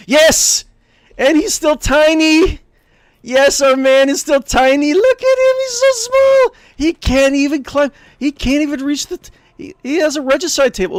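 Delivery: high, loud excited squealing; giddy, high-pitched fan excitement; delighted gushing. Real, not acted. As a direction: squeal with delight at something adorably small. Delighted at the tiny character.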